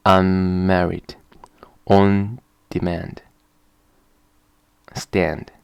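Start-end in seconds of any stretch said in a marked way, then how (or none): none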